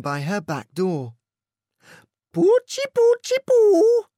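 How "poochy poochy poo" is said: very high, loud sing-song call. A narrator's reading. Missus Fortini calls her dogs to meatballs, high sing-song call. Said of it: "called Missus Fortini".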